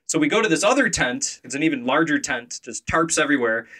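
ordinary talk

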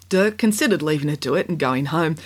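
plain reading voice

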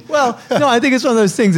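high, loud laughing protest. Real, not acted. Laughing off the teasing from his co-host. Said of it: "You've changed".

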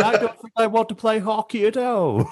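put-on a clumsy try at a New Zealand accent. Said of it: "That's the worst New Zealand accent".

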